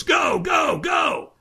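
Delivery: high, strained mock shout; trainer urging go. Trainer launching the firewalker, high mock shout. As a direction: send them off with urgent high calls. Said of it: "the trainer goes".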